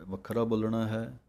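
ordinary delivery

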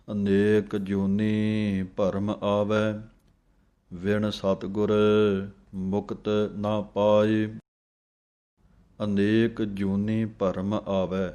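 slow, measured reading of Gurbani; scripture recitation. Shown as a demonstration. Teacher reciting Gurbani slowly for students to copy. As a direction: recite each phrase slowly and clearly.

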